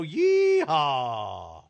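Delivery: long, drawn-out cowboy yeehaw; whooping game-show rodeo call; rodeo holler. Pretend. Rodeo catchphrase hollered by host.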